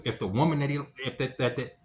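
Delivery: usual speaking voice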